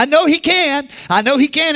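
excited hope. Acted. Woman's hope, voice jumps up.

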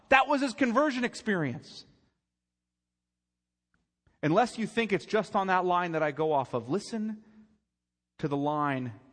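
loud, high preacher's build; preaching at full force. Acted. Preacher pressing the congregation, loud and high. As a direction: drive it home from the pulpit.

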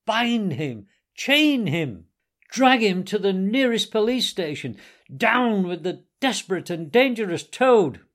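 loud, high, outraged orders; horrified outcry. A narrator's reading. Gentlemen raging at the car thief, crying out in horror. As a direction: cry out each command like a mob. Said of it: "they cried".

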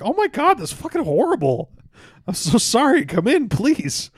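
high, loud, appalled sympathy; hammed-up horror. Saint Peter hearing the window washer's death, comically horrified.

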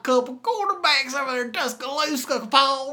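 a wild Bama fan on call-in radio; Alabama football caller. Pretend. Caller Hank ranting to Paul about quarterbacks, Southern twang. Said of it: "That's a good character".

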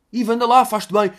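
acted pleading shout to a friend; urging friend. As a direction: playact egging a pal on. Acts friend urging Ivo.

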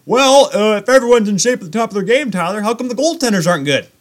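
imagined challenger. Acted. Goalie voicing a doubter's question, mock challenge. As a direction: lightly act out someone objecting. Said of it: "when anyone's about to say".

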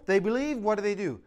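usual speaking voice

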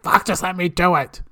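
a touch of impatient snap; impatient, let me do it; mild exasperated quote; mock frustration. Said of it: "So I'm like".

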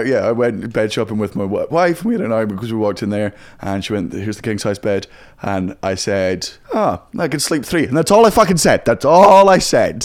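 real Irish punchline delivery; Irish accent. Retold or imitated. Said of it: "That's a lot better than".

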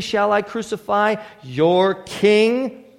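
slow, drawn-out sarcastic question with king rising. Acted. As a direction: drag out crucify, lift king mockingly. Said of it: "very sarcastically".

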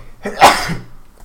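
a real cough. Real, not acted. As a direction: clear the throat with a cough. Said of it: "he's gonna start blowing his nose".

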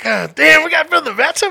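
high, loud incredulous outburst; mock-panicked high yelp; alarmed disbelief. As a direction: blurt it out in rising disbelief. Acts out alarm at bathtub order.

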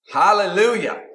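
ordinary delivery

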